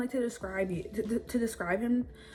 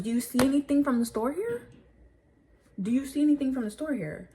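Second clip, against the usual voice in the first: louder, higher challenge; indignant yell; 5 semitones higher, 7 dB louder than usual. Retold or imitated. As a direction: throw it in his face. Retold angry confrontation with accuser.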